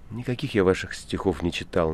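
ordinary delivery, nothing marked

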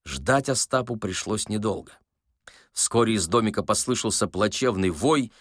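ordinary delivery

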